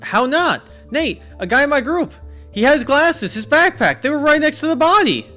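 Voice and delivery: high, loud, frantic yelling of a terrified witness; panicked screaming. Acted. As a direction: scream it like you just saw a murder. Max protests body is there.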